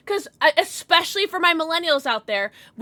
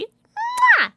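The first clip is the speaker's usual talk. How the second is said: loud, much higher smacking kiss; air kiss; 8 dB louder than usual. Real, not acted. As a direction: blow a big kiss at the viewer. Blowing a kiss to chat.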